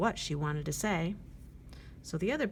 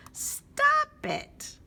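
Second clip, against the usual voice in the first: high, loud angry squawk; shrieking crow yells stop; crow's shout; 7 dB louder than usual. Acted. Crow objects loudly.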